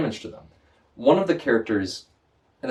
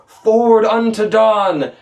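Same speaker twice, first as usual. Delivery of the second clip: loud, slow, higher battle cry; screamed command word; 11 semitones higher, 8 dB louder, much slower than usual. Retold or imitated. Player screams the ship's command word, slow loud shout. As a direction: bellow the command word out slowly. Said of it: "he screams the command word".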